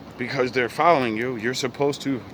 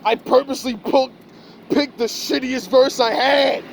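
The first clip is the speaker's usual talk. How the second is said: high, slow, pointed mock voice; mocking himself testing the crowd; inner thoughts; 10 semitones higher, 4 dB louder than usual. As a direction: voice the scheming thought, drawn out and high. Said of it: "you're looking at 'em like".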